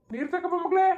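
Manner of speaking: high call across the house, stretching ಮಗ್ಳೇ. Acted. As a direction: call her to bring water. Calls for water from another room.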